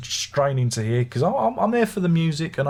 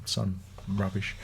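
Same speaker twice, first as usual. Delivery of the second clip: dismissive mutter; 4 semitones lower, 9 dB quieter, slower than usual. Retold or imitated. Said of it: "They go".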